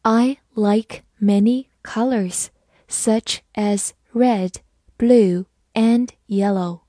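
"I like many colors, such as red, blue, and yellow" slowly, word by word; slow reading. Shown as a demonstration. Teacher reads "I like many colors, such as red, blue, and yellow" along with learners, slow reading. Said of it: "我们慢慢来读".

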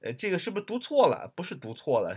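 normal talking voice

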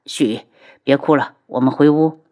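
low, soothing husband's voice; tender comforting. Acted. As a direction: gently coax them out of crying. Tender comforting of crying spouse.

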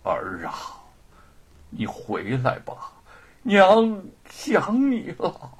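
slow, drawn-out mother's lament; muttering to herself, aching with longing; murmured lament. Acted. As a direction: call feebly for a lost son, stretching the words. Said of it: "仍在喃喃自语".

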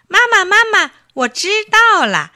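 a little bunny calling mom breathlessly eager; excited child. Acted. Bunny bursts home to mother.

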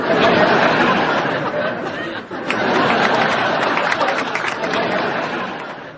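high breathy burst of laughter; big laugh. Real, not acted. Co-host laughing hard at the patient's answer. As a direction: crack up at the punchline.

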